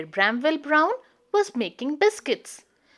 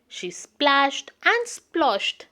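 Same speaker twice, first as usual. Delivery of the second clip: plain reading voice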